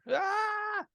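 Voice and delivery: high drawn-out wail; imitated scream. Wife on the stairs in a contraction, imitated scream. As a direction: mimic a long high cry of labor pain. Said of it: "I hear it again".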